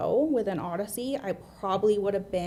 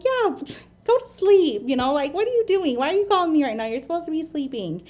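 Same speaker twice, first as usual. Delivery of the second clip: raised pitch, louder mock-annoyed nagging; retold exasperated scolding; 7 semitones higher, 7 dB louder than usual. Girlfriend to boyfriend calling when he should sleep, exasperated. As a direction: playfully shoo him off to bed, high and loud.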